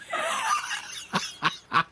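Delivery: a high, delighted burst of laughter; real amused giggle. Real, not acted. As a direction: giggle at the silly answer. Laughs at crochet answer.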